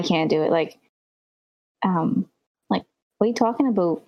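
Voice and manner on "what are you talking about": quick, put-on Canadian accent; Canadian accent, attempted in jest; mock Canadian accent. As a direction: try out an exaggerated Canadian "aboot". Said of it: "throw that accent on words".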